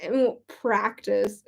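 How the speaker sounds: ordinary talk, nothing marked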